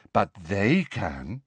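slow, measured, dignified. A narrator's reading. Luna to Ron, in a slow dignified voice. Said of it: "in a dignified voice".

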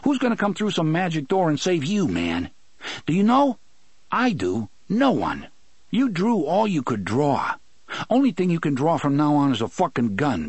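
put-on high, fast, challenging young man's voice; Eddie, bitter. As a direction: throw the questions at him, half accusing. Eddie accusing Roland.

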